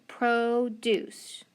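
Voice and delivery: PRODUCE drawn out as "proh-doose"; syllable by syllable. Shown as a demonstration. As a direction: sound out PRODUCE slowly, long O and long U. Blending syllables to test the word.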